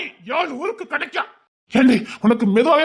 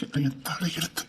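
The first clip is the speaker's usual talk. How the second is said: mock crying; 8 semitones lower, 10 dB quieter than usual. Husband bemoans fate.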